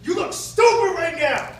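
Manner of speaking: high, loud, drawn-out mock yell. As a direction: yell it slowly right in someone's face, imitating him. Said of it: "he's, like, yelling in my face".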